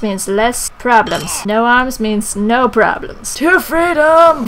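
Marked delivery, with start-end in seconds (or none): 3.4-4.5 loud, high, drawn-out rallying cry; hammed-up heroic shout; battle cry.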